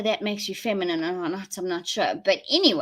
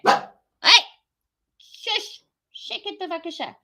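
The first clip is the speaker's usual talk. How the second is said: hushing dogs; 10 semitones higher, 4 dB louder, slower than usual. Real, not acted.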